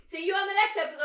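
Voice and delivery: high, soft mock sign-off; teasing mimicry. Co-host teasing her partner's botched sign-off, mocking mimicry. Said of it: "You're like this".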